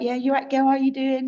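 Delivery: high, loud cheery hello; friendly client greeting. Retold or imitated. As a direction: greet her like an old mate, up high. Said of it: "They're like".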